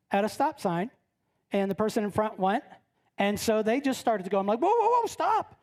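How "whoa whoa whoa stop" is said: high, urgent repeated whoa; alarmed warning. Retold or imitated. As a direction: stop the driver in sudden alarm. Passenger alarmed as driver moves off.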